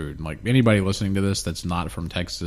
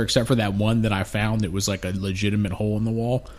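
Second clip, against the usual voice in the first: ordinary talk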